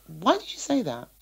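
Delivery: normal talking voice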